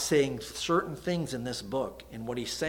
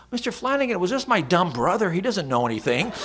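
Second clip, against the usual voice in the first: much higher and louder, an eager sixth grader; kid's voice; 7 semitones higher, 7 dB louder, faster than usual. Retold or imitated. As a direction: pipe up like a boy brushing it off. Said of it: "And he goes".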